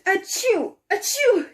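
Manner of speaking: two sharp high sneezes; loud acted double sneeze; sneezing. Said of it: "she sneezed".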